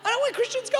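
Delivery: loud, rising sermon shout; full-force preaching. Acted. Preacher to congregation, loud preaching shout. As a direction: preach it out to the whole church.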